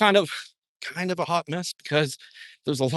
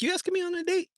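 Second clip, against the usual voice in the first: mock cashier. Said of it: "She's like".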